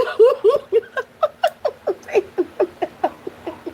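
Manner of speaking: long helpless cackle; long real laugh. Real, not acted. Reactor watching the fight video, helpless laughter. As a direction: crack up until breath runs out.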